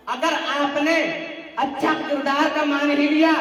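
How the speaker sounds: insistent rhythmic sermon argument; forceful preaching. Real, not acted. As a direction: drive each question home to the congregation. (ज़ोरदार तक़रीरी अंदाज़)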